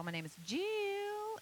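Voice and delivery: "Jill" stretched high and slow in a Tennessee drawl; "Jill" sung out long in a Southern drawl. Shown as a demonstration. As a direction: draw out "Jill" over three syllables, Tennessee style. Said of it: "Granny Franny's from Tennessee".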